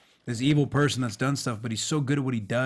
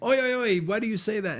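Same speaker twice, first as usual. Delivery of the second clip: high, loud mock Aussie comeback; imitated Australian co-star; 11 semitones higher, 4 dB louder than usual. Australian replying to the American's chant, high imitated protest. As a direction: chant back, then question it in mock protest. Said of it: "And he's like".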